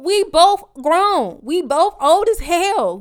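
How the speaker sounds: loud, high, slow insistence; slow, loud, exasperated declaration; emphatic shout. Real, not acted. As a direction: hammer home that they're grown. Insisting they are both adults.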